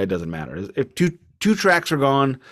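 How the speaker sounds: ordinary talk, nothing marked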